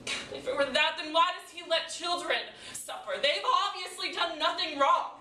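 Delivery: impassioned challenge; indignant protest. Acted. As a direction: demand an answer, building as you go. Monologue, protesting children's suffering.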